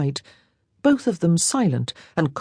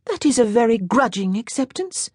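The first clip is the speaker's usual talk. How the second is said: put-on lightly higher girlish voice for Jeanne; young French girl, a little higher; 6 semitones higher than usual. A narrator's reading.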